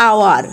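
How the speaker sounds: আওয়ার said as "aowar" with no h sound at the start; silent h in hour. Shown as a demonstration. Teacher showing students that আওয়ার begins with a vowel sound. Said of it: "কিন্তু উচ্চারণটা দেখো কি হচ্ছে".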